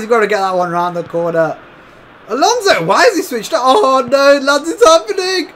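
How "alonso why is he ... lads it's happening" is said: higher and higher, panicked commentary; panicked gamer shouting at the screen; rising panic. Real, not acted. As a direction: alarm building as the attack comes. Opponent breaks through on goal.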